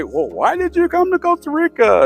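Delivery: high-pitched imitation of nosy small talk; mock inquisitive chit-chat; curious questioners. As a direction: fire off the stock questions up high. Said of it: "people would say".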